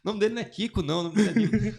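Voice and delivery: crying with laughter while setting it straight; laughing through tears. Retold or imitated. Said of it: "chorar de rir".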